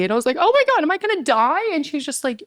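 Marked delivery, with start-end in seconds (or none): 0.4-1.6 acting out a high, panicked question; higher-pitched replay of fearing the worst; retold panic. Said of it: "I'm, like, freaking out".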